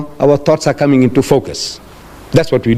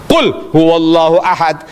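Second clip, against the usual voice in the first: measured Arabic recitation, first word ringing out; Quran recitation; much faster than usual. Acted. Prophet uttering the revealed answer, in solemn recitation. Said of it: "he's on a different level".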